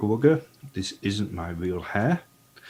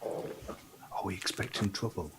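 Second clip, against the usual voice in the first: soft whispered question; whispering; 9 dB quieter than usual. Acted. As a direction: whisper it, wary of danger. Sneaking party, quiet question.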